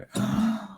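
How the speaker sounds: long hard puff of air; blowing out hard. Real, not acted. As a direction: blow a sharp rush of breath. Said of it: "A long, hard blow".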